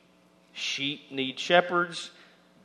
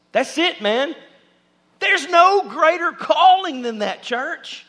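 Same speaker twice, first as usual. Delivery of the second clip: much higher and louder, exultant; full preaching shout; 11 semitones higher, 9 dB louder than usual. Real, not acted. Pastor calling the church to its highest calling, shouting. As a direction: ring it out to the back row.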